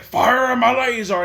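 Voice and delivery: high, loud hammy villain cry; villain shout. Retold or imitated. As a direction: shout it like a cartoon baddie. Mocking villain's line.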